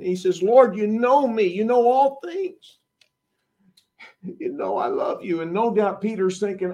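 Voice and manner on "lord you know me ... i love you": broken plea. Acted. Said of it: "Peter is broken".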